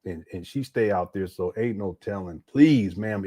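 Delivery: ordinary talk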